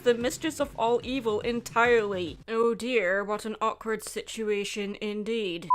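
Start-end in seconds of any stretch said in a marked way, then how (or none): none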